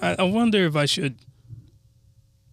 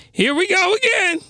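"Here we go again" pitched much higher, falling on "again"; 13 semitones higher than usual. Shown as a demonstration. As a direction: leap way up in pitch for a silly take on "Here we go again". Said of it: "you can change the inflection of your voice".

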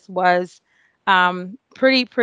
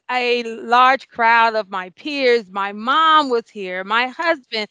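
ordinary talk

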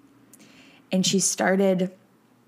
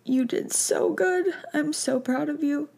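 tender reassurance; 8 semitones higher than usual. Retold or imitated. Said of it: "just being like".

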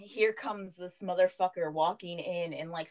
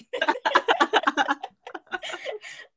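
loud laugh; 14 semitones higher, 8 dB louder than usual. Real, not acted. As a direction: burst of loud high laughter. Laughing about the punch story.

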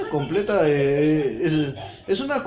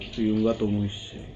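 sexy cat voice; 8 semitones lower, 3 dB quieter than usual. Pretend. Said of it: "Es como yo ser un gato".

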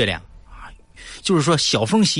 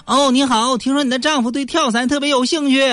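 reporter voice pitched much higher; high-voiced reporter; 13 semitones higher than usual. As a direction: play the eager reporter high. TV reporter interviewing wife. (高声模仿记者)

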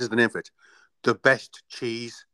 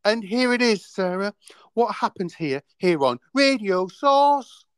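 announcer voice; 8 semitones higher than usual. Acted. Host announcing show segment.

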